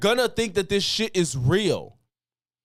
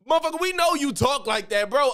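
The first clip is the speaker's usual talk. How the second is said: exasperated; 8 semitones higher than usual. Real, not acted. Exasperated at the politician.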